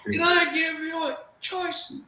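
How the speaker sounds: high, furious shout; drill instructor shout. Retold or imitated. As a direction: roar it at the recruit. Senior shouts after tipping desk.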